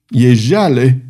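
ordinary delivery

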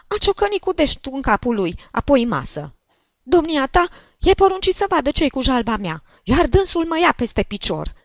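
high, loud tirade of complaint; shrill old woman demanding justice; indignant old woman. A narrator's reading. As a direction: pour out grievances at full pitch.

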